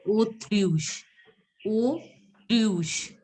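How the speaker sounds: autruche modelled in separated sounds, o then truche; French autruche. Shown as a demonstration. Teacher showing how ostrich is said, autruche in French. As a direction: autruche with an o opening and yoo-like u. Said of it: "So it is pronounced as".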